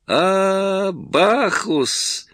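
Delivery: high, ringing exclamation of greeting; exclaiming. A narrator's reading. Носков hails the actor in delight, exclaiming. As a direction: call out the name with glee. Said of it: "воскликнул Носков".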